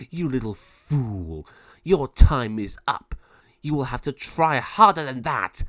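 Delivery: slow, loud villain taunt between cackles; evil cackle and taunt. Acted. Villain taunting the bird, loud with cackles. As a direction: cackle then sneer.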